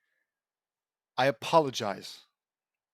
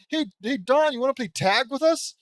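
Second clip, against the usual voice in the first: mock chipper kid voice; 9 semitones higher, 9 dB louder than usual. Mocking the cheerful characters.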